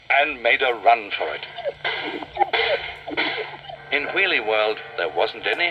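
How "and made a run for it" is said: plain reading voice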